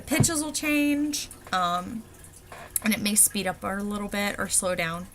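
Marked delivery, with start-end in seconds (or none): 0.0-1.3 changing pitch. Shown as a demonstration. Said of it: "you're gonna use pitch and pace".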